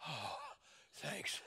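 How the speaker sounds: low, soft sigh of relief; hushed, hammed-up contentment; mock calmed-down child. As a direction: play a kid suddenly soothed and grateful. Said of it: "they just calm down and go".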